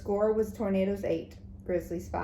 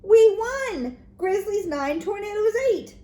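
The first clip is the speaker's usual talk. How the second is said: much higher and louder; excited shout; 11 semitones higher, 7 dB louder than usual. A narrator's reading. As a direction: announce the score in triumph. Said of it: "said Jimmy".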